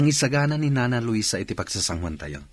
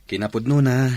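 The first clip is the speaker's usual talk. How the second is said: emphatic; 4 semitones higher, 7 dB louder than usual. Acted. Insisting she has no boyfriend.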